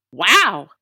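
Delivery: ordinary delivery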